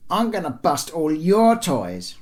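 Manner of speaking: put-on high, loud, slow childish threat; retaliating young boy. A narrator's reading. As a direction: threaten like a cross little boy, drawing it out. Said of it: "retaliated Le Bon".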